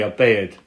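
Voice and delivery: "bed" with an A-like vowel, near "bay-ed"; old London "bed", vowel stretched toward A; nan's pronunciation. Retold or imitated. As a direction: say "bed" almost with an A. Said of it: "almost like with an A".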